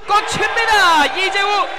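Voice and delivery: high, loud burst of excitement; excited shout. Real, not acted. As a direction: roar the made three. Play-by-play three-pointer made.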